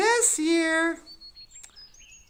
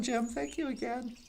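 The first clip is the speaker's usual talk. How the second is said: usual speaking voice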